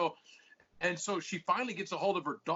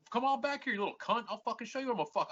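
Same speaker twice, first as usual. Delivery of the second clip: worked up, higher-pitched retelling of a threat; pissed-off retort; 7 semitones higher than usual. Retold or imitated. Retelling his challenge to the dog walker, pissed off. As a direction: re-enact squaring up to him. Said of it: "I was fucking pissed, dude".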